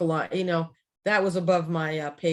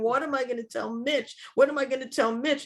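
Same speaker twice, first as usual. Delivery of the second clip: higher, half-laughing panicked wail; panicked crying, retold; 7 semitones higher than usual. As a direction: relive the panic over the dead fish, half laughing. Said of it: "I'm, like, hysterical crying".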